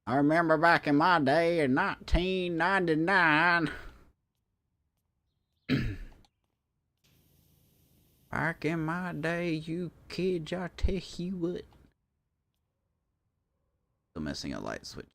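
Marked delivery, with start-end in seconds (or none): 0.1-3.7 put-on slow, higher old-timer voice; creaky old-timer reminiscing; old person voice. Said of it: "I was making, like, an old person voice". 8.3-11.6 put-on slow, high grumpy old-timer; old person voice. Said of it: "I was making, like, an old person voice".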